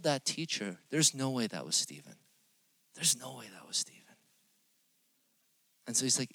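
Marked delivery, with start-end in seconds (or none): 2.9-4.2 whispered, disbelieving repeat. Retold or imitated. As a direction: drop to a whisper on "There's no way that was Steven". Teacher's quote repeated twice.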